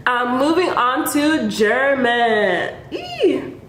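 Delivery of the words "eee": normal talking voice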